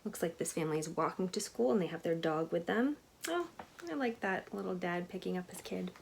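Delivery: plain reading voice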